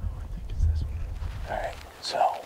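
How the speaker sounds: hushed stalking whisper; wildlife watching whisper. Real, not acted. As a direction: whisper so the deer won't hear.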